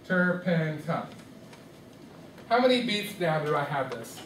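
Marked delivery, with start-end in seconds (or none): 0.1-1.1 Turpentine slowly split into TUR-PEN-TINE; syllable by syllable. Shown as a demonstration. Teacher counting syllables with students, syllable by syllable. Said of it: "How many syllables is this".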